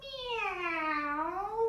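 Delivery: high cat meow; playful high meow for cat pose. Pretend. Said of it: "you might wanna do a meow".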